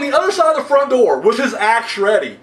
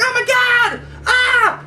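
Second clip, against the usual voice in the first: high hammed-up shriek; a playful mock scream of terror; 12 semitones higher than usual. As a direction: shriek like a horror victim. Imitating her screaming.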